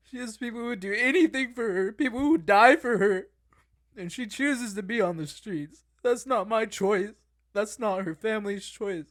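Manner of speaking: real frustration, pushing the words out hard; heated rant. Real, not acted. Heated rant about his sister.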